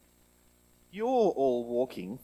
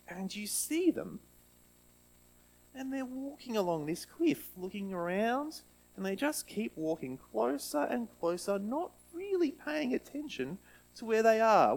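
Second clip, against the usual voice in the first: put-on higher, lively storytelling voice for kids; reading to children; 6 semitones higher than usual. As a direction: paint the scene for the little ones.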